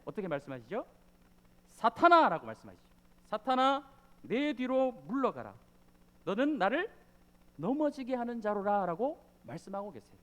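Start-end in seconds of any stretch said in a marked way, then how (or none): 1.7-2.5 much louder sharp cry of Satan; harsh rebuke. Acted. Jesus turning on Peter, loud harsh rebuke. 3.3-9.2 stern rebuke. Acted. Jesus rebuking Peter.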